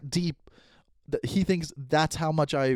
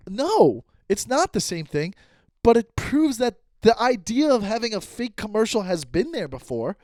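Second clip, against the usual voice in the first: raised pitch, emphatic pushback; retold heated rebuttal; 6 semitones higher than usual. Retelling his comeback to the accuser, heated and insistent. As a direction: argue back insistently, as if mid-quarrel.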